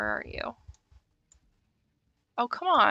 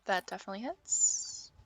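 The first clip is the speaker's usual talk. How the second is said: whispering; 9 dB quieter than usual. Real, not acted. DM quietly confirms hit.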